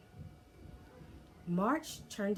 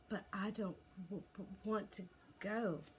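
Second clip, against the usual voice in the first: softer, stammering little dragon; reasonable little dragon; 3 semitones higher, 5 dB quieter than usual. Acted. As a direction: gently insist like a small dragon. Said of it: "equally reasonably".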